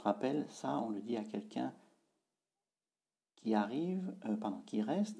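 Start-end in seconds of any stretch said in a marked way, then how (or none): none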